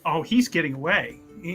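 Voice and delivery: normal talking voice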